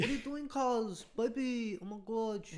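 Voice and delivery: high-pitched Bankstown accent impression; mock a Bankstown girl's accent, pitched right up; Bankstown chick accent. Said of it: "Those chicks, bro".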